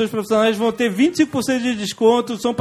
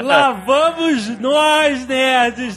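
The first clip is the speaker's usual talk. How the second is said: drawn-out launch call; 2 semitones higher, much slower than usual. Acted. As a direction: stretch it out like a takeoff cry. Hosts launching the episode. (chamada arrastada e animada)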